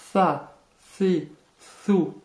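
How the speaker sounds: "Tha, thi, thu" as a light S with tongue slightly out; exaggerated lisped th. Shown as a demonstration. Teacher exaggerating so learners understand, lisped th. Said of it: "en sortant légèrement la langue".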